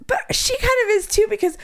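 high-pitched mock indignation; retold incredulous scolding. Boss scolding her for asking in a doctor's office, mock incredulous. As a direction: imitate an appalled telling-off, pitched high.

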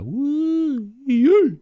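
high, drawn-out whoop of delight; excited whoop. Retold or imitated. Imitating own reaction to comic art, high whoop. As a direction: cheer like a fan seeing the art. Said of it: "I'm like".

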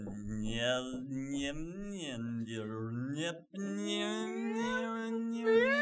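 chirpy reversed-tape gibberish; backwards tape noise. Pretend. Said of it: "I'll do the".

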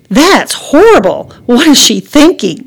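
slow, loud, high mock outrage; a mother faking horror at homework; sarcastic. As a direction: drip sarcasm over every word. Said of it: "Mama's sarcasm poured out".

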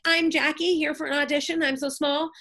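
small, timid high voice introducing herself at the gate; aspiring actress at the studio gate, timid and small; mock meek newcomer. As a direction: play a nervous nobody asking to be let in. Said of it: "without having to be like".

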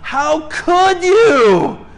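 put-on high, loud, very slow wounded child voice; hammed-up wounded baby wail; betrayed baby. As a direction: drag it out like a hurt toddler accusing the parent.

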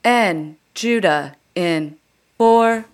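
slow automated transit announcer with long pauses; Muni announcement voice. Acted. Comic doing Muni impression for co-host, automated announcer voice. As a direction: read out arrivals word by word, evenly spaced. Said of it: "do you wanna hear my Muni one?".

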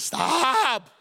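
loud, high drawn-out yell; panicked yell. Acted. Boy alone trying to stop his racing mind, panicked yell. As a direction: yell it to halt your own thoughts.

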